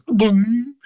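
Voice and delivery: sudden high-pitched "boom" escaping; vocal slip. Retold or imitated. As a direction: imitate a voice accidentally shooting up out of control. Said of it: "slightly out of control in your vocal cords".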